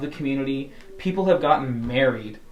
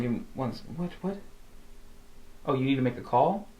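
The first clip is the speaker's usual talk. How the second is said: normal talking voice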